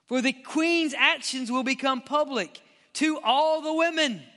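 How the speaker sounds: high, emphatic official's accusation; forceful preacher reading a royal adviser's speech; dramatic scripture reading. Acted.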